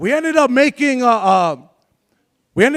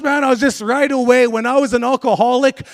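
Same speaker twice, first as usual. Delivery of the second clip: ordinary talk, nothing marked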